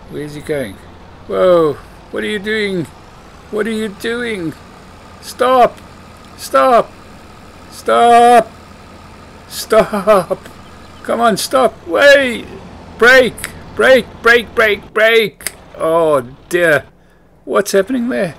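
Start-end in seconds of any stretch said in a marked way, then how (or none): 1.3-15.5 high, loud, urgent yells, building to a peak; panicked shouting. Pretend. Shouting at the driver of a runaway harvester, panicked. 15.8-18.3 high, loud dismay; alarmed exclamation. Pretend. Watching the harvester driver go astray, alarmed.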